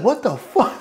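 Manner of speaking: high-pitched mock mom outburst, laughing; shocked mom. Mom hears he's deploying, high laughing mock shock. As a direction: imitate his mom's stunned reaction with a laugh. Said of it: "She's like".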